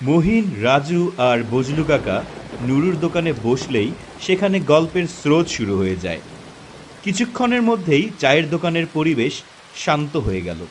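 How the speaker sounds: usual speaking voice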